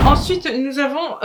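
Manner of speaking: ordinary talk, nothing marked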